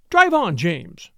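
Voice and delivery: curt order to the coachman; calling out. Acted. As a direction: call to the coachman on the box. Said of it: "she called out to the coachman".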